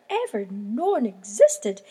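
ordinary delivery, nothing marked

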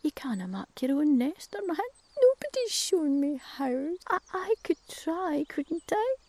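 tease, then let the cuckoo answer small; blunt Scots crow. Acted. Crow bluntly agreeing the cuckoo is a parasite. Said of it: "says Crow".

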